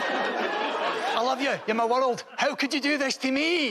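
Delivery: high, pleading, laughing through it; a jilted Scotsman begging, half laughing; comic De Niro gone Scottish, pleading with a lover; heartbroken Scottish De Niro. Acted. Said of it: "a Scottish man who can't believe you're breaking up with him".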